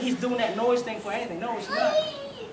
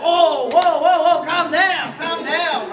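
loud, higher pleading whoa; calming someone down; 4 semitones higher, 7 dB louder, much slower than usual. Retold or imitated. Person backing away from an angry cat, loud pleading calm down. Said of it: "You're like".

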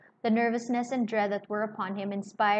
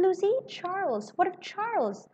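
alarmed; 10 semitones higher than usual. A narrator's reading. Lorry alarmed by news.

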